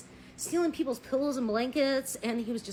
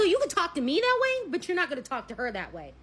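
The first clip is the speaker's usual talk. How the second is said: protective rebuke; 6 semitones higher, 5 dB louder than usual. Retold or imitated. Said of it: "I go".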